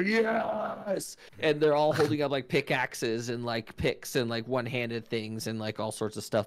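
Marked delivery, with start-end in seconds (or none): 0.0-1.2 high excited yell; dwarf cheer. Acted. Dwarf team cheering their sponsor, high yell. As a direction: shout it like a rowdy dwarf. Dwarves yelling in the crowd.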